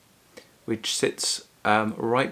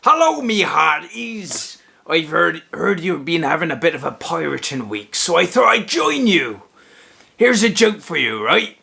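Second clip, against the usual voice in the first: loud, high-pitched hammed-up pirate; 7 semitones higher, 7 dB louder than usual. Greeting the hearties and telling a pirate joke, hammed-up pirate voice. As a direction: boom it out like a stage pirate. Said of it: "stop talking like this".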